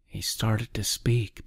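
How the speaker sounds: ordinary delivery, nothing marked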